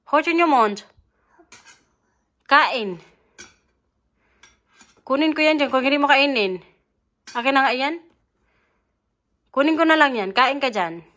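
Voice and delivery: loud firm mother's warning; stern scolding. Real, not acted. Scolding child at meal.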